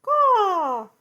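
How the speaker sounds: very high, loud cawing; shrill mocking crow. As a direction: caw it out like a crow.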